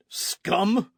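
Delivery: high, loud spat insult; furious cry. A narrator's reading. Don Carlos hearing his wife will be arrested, furious loud cry. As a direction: spit the word at him. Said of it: "Don Carlos cried".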